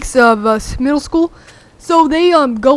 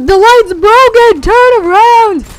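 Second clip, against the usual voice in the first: high, loud, slow shouted warning; retold scream; 9 semitones higher, 7 dB louder, slower than usual. Friend yelling at waiting drivers to turn around, loud. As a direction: bellow it down the line of cars. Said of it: "he was screaming".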